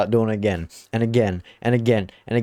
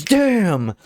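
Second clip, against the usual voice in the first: mock frustrated damn; 8 semitones higher, 3 dB louder than usual. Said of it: "He goes".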